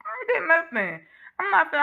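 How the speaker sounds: ordinary delivery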